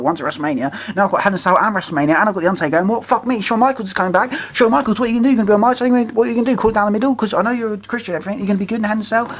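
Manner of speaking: worried rambling, pitch climbing as the doubts pile up; lightly voiced wrestler fretting over his match; panicked Triple H. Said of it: "Triple H going".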